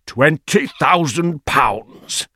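slow, high, stunned exclamation; astonished cry. A narrator's reading. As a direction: stretch each word out in disbelief. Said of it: "cried Ralph".

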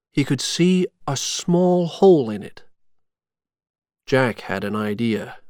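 ordinary delivery, nothing marked

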